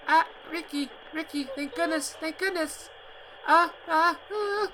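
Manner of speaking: put-on high girl's voice trembling with fear; cling to him, terrified and relieved; high frightened girl on the phone; shaking with fear. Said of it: "Her voice was shaking".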